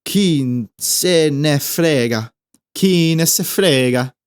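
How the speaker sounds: spelled out slowly; slow demo. Shown as a demonstration. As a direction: say the phrase very slowly for learners. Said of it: "In Italian".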